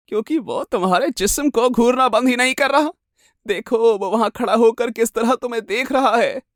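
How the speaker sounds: high-pitched, accusing madwoman; crazed jealous wife. Acted. Jealous wife pointing out her watching husband, crazed and high.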